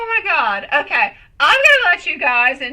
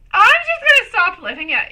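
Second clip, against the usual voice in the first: ordinary talk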